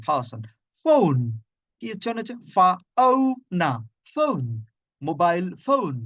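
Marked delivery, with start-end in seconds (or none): none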